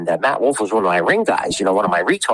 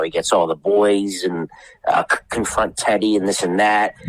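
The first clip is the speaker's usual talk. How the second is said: ordinary talk, nothing marked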